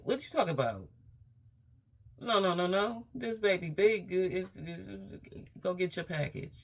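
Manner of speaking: high, loud, insistent doctor voice; imitating the doctor. Mimicking how her doctor scolded her about the pregnancy. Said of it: "that's how he talked to me".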